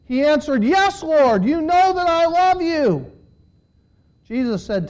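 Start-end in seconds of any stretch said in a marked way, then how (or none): 0.6-3.1 high, insistent declaration; emphatic insistence. A narrator's reading. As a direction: insist with feeling, pitched well up. Peter's emphatic second answer.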